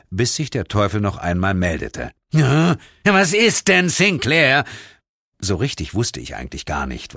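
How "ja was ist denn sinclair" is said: slow, loud, put-upon demon voice; a bored devil bothered again; annoyed devil. Acted. The devil answers. (genervte Teufelsstimme)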